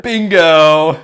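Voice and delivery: loud, high, drawn-out cheer; triumphant shout. Real, not acted. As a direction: call it out like a game winner. Calls out a correct guess.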